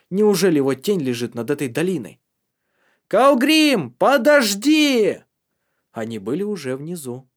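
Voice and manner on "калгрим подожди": high, loud shouted plea, the last word drawn out slowly; a long carrying shout; calling out. Acted. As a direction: yell for him to wait. Tari calls, running down.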